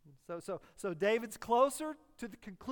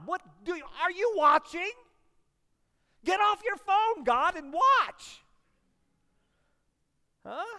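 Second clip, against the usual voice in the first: loud, high exasperated yelling at God; mock angry at God; 8 semitones higher, 11 dB louder than usual. A frustrated believer shouting at God to look up. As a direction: rail at God like a fed-up believer.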